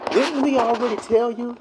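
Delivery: high put-on nagging voice; mock scolding. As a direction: nag like an annoyed crowd. Israelites scolding Moses.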